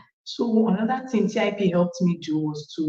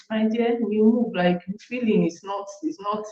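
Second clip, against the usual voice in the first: usual speaking voice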